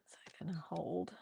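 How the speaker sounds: whispered to herself; quietly unsure, under breath. Real, not acted. Muttering while adjusting setup.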